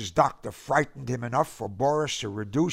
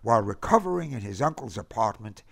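usual speaking voice

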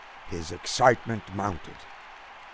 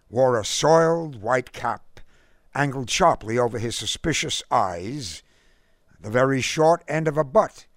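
usual speaking voice

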